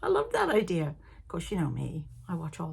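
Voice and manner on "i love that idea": high-pitched delighted gushing; excited enthusiasm. Real, not acted. Fan praising a podcaster's football stitching idea, excited and high. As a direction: gush about it, pitch leaping up.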